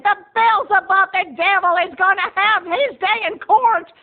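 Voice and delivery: very high, loud, slow hellfire shriek; mock preaching fury. [name] waving her Bible at her sister, shrieking. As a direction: rail like a furious preacher with a Bible.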